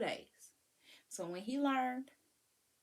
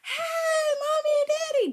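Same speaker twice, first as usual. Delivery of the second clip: put-on high, loud, excited child's call; kids greeting; 12 dB louder than usual. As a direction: squeal it like a kid running to the door. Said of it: "the kids are".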